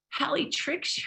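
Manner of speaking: drops to a near whisper; unvoiced, secretive hush. Real, not acted. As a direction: lean in and hush it.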